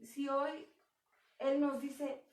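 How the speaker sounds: ordinary delivery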